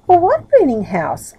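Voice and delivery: surprised. Acted. Dame Trotabout asks Middle which house, surprised. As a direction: ask it baffled and taken aback. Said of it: "asked Dame Trotabout in surprise".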